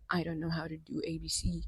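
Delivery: breathy whispered quote; whispering. Retold or imitated. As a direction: whisper the excuse mockingly. Mocking self-doubt quote.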